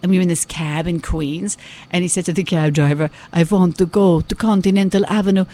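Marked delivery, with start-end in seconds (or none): 3.3-5.4 imitated Hungarian father, Dracula-style; Hungarian Dracula accent. Daughter mimicking her father instructing a cab driver, Hungarian Dracula accent. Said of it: "he sounds like Dracula".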